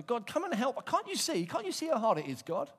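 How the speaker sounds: high pleading whine; whining prayer. Pretend. Preacher voicing a worried believer, high pleading prayer. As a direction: beg God like a frightened child.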